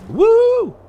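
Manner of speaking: big high yell of joy, full voice; excited whoop. Real, not acted. Gamer cheering at his screen, loud high whoop.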